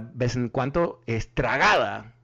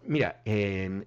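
ordinary talk, nothing marked

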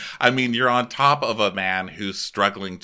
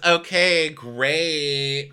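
high, louder sarcastic okay; 7 semitones higher, 4 dB louder, much slower than usual. Retold or imitated. Host voicing Ashley brushing off Tom, sarcastic. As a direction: toss it off dismissively. Ashley's dismissive reply imitated.

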